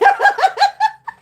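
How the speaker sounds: high, loud shrieking laugh; shrieking laughter. Real, not acted. Laughing hard.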